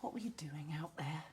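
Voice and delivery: soft, breathy worried whisper; whispered question. Acted. Mother whispering to her daughter in hospital, worried. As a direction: hush it, gently anxious.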